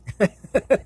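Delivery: high giggle at own joke; a quick amused giggle; silly laugh. Real, not acted. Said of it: "I'm feeling silly today".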